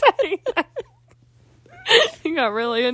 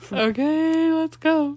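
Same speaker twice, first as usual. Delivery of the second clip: meme voice; 5 semitones higher, much slower than usual. Retold or imitated. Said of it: "you know that meme".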